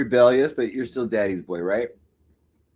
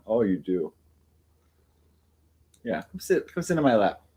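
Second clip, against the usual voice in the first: normal talking voice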